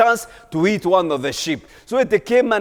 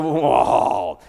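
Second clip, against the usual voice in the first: attempted roar; 7 semitones lower than usual. Lion tries to roar.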